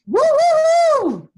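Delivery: high, loud cheerleader whoops; cheering woos. Real, not acted. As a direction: whoop it up for the crowd. Cheering before the school chant.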